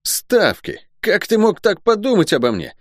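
high, outraged guardsman voice; comic, high-pitched affront; puffed-up indignation. A narrator's reading. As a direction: protest like an offended turkey-cock. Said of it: "не унимался Лук, надувшись точно индюк".

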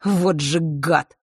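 slow, drawn-out disgust; dragging the words in distaste. A narrator's reading. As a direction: spit out the insult slowly, revolted. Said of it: "Он с отвращением скривился".

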